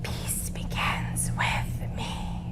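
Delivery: slow, voiceless whisper; whispered mantra. Shown as a demonstration. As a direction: whisper it slowly together. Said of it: "let's whisper it together".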